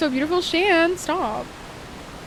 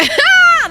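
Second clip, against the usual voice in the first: sudden loud squeal of laughter; loud high laugh; 8 dB louder than usual. Real, not acted. Laughing at own joke.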